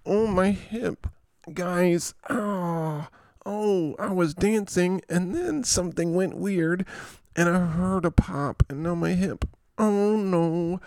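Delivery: high, whiny injured player; T Hill. Acted. Injured player telling guys about his hip, mock whining. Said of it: "T Hill has a Q".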